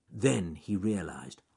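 plain reading voice

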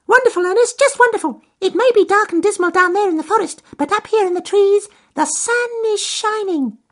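very high, fast little bird; chirpy bird voice. Acted. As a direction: chirp it down from the treetops. Robin answers from trees.

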